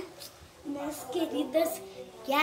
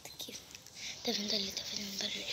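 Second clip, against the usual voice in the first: whispering; 3 semitones lower, 8 dB quieter, much faster than usual. Real, not acted. Monster making noise nearby.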